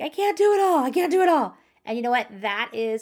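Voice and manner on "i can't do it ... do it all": high, loud, frantic shout; overwhelmed mom yelling at her kids; desperate yell. Retold or imitated. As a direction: cry out that you can't cope any more. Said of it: "But I literally just yelled".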